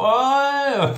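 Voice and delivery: high, loud indignant troll voice; mocking commenter. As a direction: squeal like an outraged commenter. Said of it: "les commentaires ... du genre". (internaute indigné, aigu et fort)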